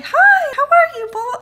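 bright surprised hello at the door; cheery neighbour. Retold or imitated. Imitating the neighbour greeting her at the door, high and loud.

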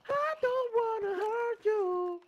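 slow, very high mock squeal; high squeaky voice. Mocking teammate's line.

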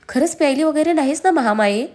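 mildly irritated interrogation; exasperated. A narrator's reading. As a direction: ask it fed up and suspicious. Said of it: "त्याने वैतागत विचारलं".

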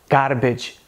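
"garbage" with a tapped Indian R; Indian English accent. Shown as a demonstration. Teacher showing the R, Indian accent. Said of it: "Já no inglês indiano".

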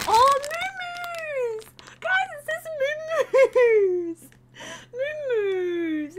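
high-pitched excited squeal; delighted squeal. Real, not acted. Squeals at the cow candy.

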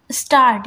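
स्टार्ट with an open long आ; long aa in start. Shown as a demonstration. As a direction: lean on the आ of स्टार्ट. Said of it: "आ की sound ला रहा है". (स्टार्ट में लंबा आ)

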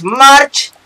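high, loud drawn-out call of March; Homer calling Marge. Pretend. Gamer imitating Homer calling his wife, loud and high. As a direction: bellow March like Homer at home.

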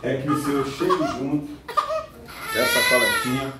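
murmured prayer asking God for help. Real, not acted. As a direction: pray under your breath while working. Prays aloud during blood draw.

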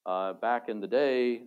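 normal talking voice

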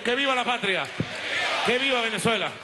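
shouted rally slogans; ranting dictator speech. Real, not acted. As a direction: roar the slogans, high and proud. Closing vivas shouted to the nation. (consignas gritadas)

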